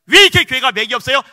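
loud, high rhetorical question pressing on 왜; fiery preaching. Acted. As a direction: hammer 왜 like an accusation to the crowd. Preaching at full force.

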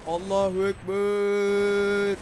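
slow, drawn-out hammed-up war cry; mock battle cry. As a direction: stretch it out like a charge.

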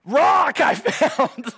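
put-on high, loud excited little boy; shouting kid voice, high and slow on the last words. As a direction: show off the rock like a thrilled six-year-old. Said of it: "and he's like".

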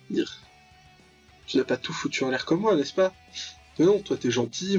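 ordinary talk, nothing marked

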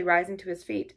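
plain reading voice

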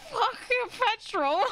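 high, loud, drawn-out mimicry; Apple. Streamer mimicking a friend's clip for chat, exaggerated impression. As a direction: stretch it out, mocking his odd delivery. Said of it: "Why did he say fucking petrol like that".

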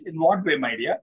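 normal talking voice

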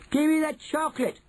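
put-on high-pitched boy's demand; high, insistent little-boy yell without full volume; Bruno shouting. A narrator's reading. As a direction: shout like an impatient child. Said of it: "shouted Bruno".

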